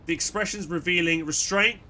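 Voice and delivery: ordinary delivery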